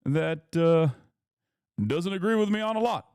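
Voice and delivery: a rough, lightly voiced Obama with measured pauses; halting, deliberate presidential cadence, barely practiced; Obama impression. Streamer reads Obama's interview quote in a shaky Obama impression. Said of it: "practicing my Obama".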